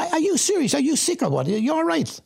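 can't believe what he's hearing; incredulous disbelief. Retold or imitated. Answering his born-again brother on the phone, incredulous.

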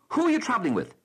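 loud, high mock interrogator's bark; interrogating bark. Rail official grilling a passenger, hammed-up loud bark. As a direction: grill her like a border guard. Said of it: "she barked in a sort of interrogating voice".

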